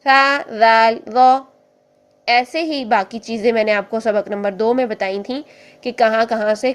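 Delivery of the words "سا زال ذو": tongue-tip letters said slowly and clearly; demonstrating letters. Shown as a demonstration. Teacher demonstrating letter sounds to students. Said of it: "تین حروف یہاں سے ادا ہو رہے ہیں".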